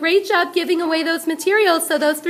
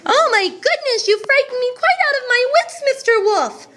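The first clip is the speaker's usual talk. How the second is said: mock fright; 7 semitones higher than usual.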